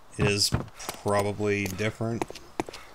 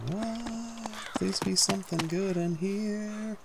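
hopeful squeak; 10 semitones higher, 8 dB quieter, slower than usual. Real, not acted. Hoping for loot in game.

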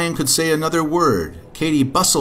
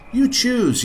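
high, childlike mock yell; lightly yelled storybook reply; mild yell; 11 semitones higher than usual. A narrator's reading. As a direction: call out high without much volume. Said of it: "yelled Katy and Jack".

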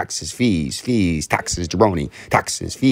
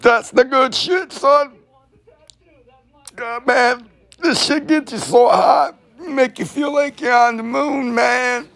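much higher, slow, dazed stoner drawl; a buddy floating high on the good stuff; stoned stoner voice; slower than usual. Acted. Buddy bragging about his weed, stoned high voice. Stoner bit while smoking mota.

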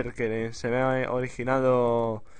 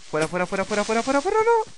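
rapid repeats climbing to a loud squeal; mounting panic; 9 semitones higher, 4 dB louder, faster than usual. Real, not acted. Player under attack in a game, mounting panic. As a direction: start urgent, end in a high-pitched panic.

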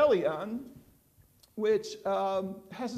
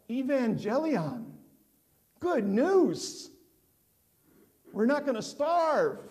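mild mock yell of a lookout, raised and higher; 4 semitones higher than usual. Lookout spotting the food ship, mock calling out. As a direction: call Evangelion to the starving city, half-performed. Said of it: "they'd yell out".

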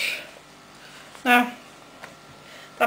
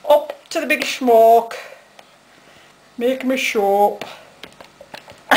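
loud, hammed-up Dublin accent, pitched up; 4 semitones higher, 7 dB louder than usual. Teasing Titchy by imitating their way of talking, exaggerated Dublin accent. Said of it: "You talk in the other Dublin accent".

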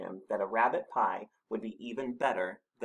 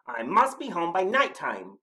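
higher, louder urgent cry; gasped worry; 8 semitones higher, 8 dB louder than usual. A narrator's reading. Snorklum seeing night has come, mildly gasped alarm. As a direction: fret aloud with mild alarm. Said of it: "gasped the Snorklum".

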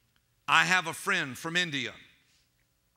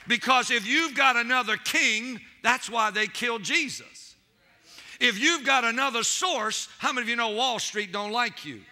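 loud, higher preaching cadence; fiery preaching; 5 semitones higher, 4 dB louder than usual. Real, not acted. Preacher warning the saints about other kings, fiery. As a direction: preach it hard to the crowd.

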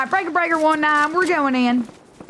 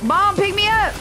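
mock child plea; 4 semitones higher than usual. Downed player mock child plea.

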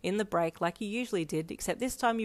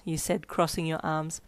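usual speaking voice

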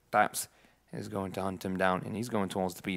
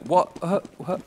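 confused surprise; 9 semitones higher, 6 dB louder than usual. Retold or imitated. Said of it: "I think he was like".

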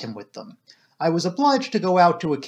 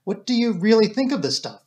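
higher-pitched, mildly anxious question; nervous; 6 semitones higher than usual. A narrator's reading. Doctor asking Poirot about his manuscript, mildly nervous. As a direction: ask with a touch of nerves. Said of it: "I asked nervously".

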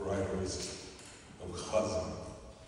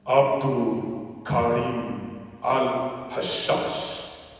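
louder, rising spoken-word delivery, building in fervor; impassioned poem recital; 3 semitones higher, 10 dB louder, slower than usual. Acted. Poet invoking martyred Gaza writers to the gathering, impassioned recital. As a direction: call each name out and lift the lines like a hymn.

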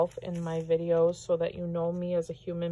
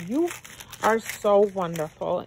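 ordinary delivery

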